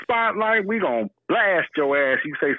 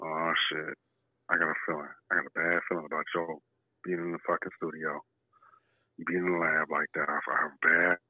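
ominous low voice; 11 semitones lower, 9 dB quieter than usual. Real, not acted. Dread about Joe in studio.

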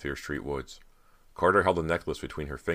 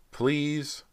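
high, drawn-up plea; pleading; 12 semitones higher than usual. A narrator's reading. Carter begs silent Dan to come over, pleading. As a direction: coax with a high questioning please.

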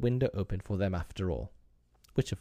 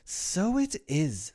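put-on slow, high boyish wonder; amazed; 10 semitones higher, 3 dB louder, much slower than usual. A narrator's reading. John peeping at his mother, amazed. John echoes Wendy's discovery.

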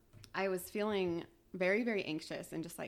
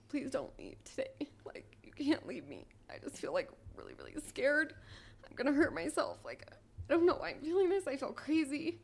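tearful plea; 6 semitones higher than usual. Retold or imitated. Retelling begging husband to stay.

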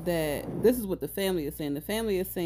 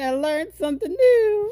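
laughing cry; 12 semitones higher, 7 dB louder than usual. Real, not acted. Laughing at her mistake.

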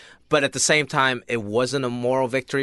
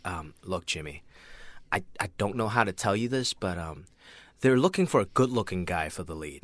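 hushed game show host agent; soft, smooth talent agent delivery; 6 semitones lower, 7 dB quieter than usual. Retold or imitated. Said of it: "you just broke into your agent voice".

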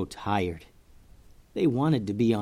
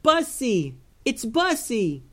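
higher distant call of recognition; yell from the boat; 11 semitones higher, slower than usual. Acted. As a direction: call out from across the water. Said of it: "they heard someone on a boat yell".

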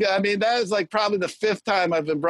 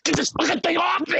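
frantic yell; 9 semitones higher than usual. Acted. Acting cokehead ripping off sweater.

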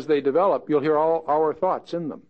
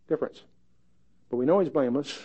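normal talking voice

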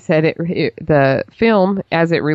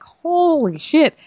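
slow, high "Holy shit"; shocked; 10 semitones higher, much slower than usual. Retold or imitated. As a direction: draw it out in shock. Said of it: "And I'm like".

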